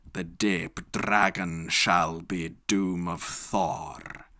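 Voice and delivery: ordinary delivery, nothing marked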